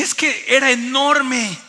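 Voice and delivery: loud, high, stretched-out amazement; bursting with excitement. Retold or imitated. Husband telling wife about the huge whale, bursting with excitement. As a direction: marvel at it loudly and slowly. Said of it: "no cabía de la emoción".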